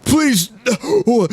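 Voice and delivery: high, breathless begging; gasped plea. Acted. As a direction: gasp out a desperate plea. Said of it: "gasped the being of light".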